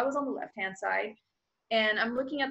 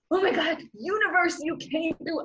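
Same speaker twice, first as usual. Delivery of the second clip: higher, louder joyful thanks; excited relief; 7 semitones higher, 4 dB louder than usual. Retold or imitated. Thanking the universe after clearing immigration, excited and high. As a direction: burst out with grateful joy.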